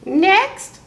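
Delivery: high, rising bird squawk; parrot squawk. A narrator's reading. Parrot calls the next rascal, high squawk. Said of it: "squawked the bird".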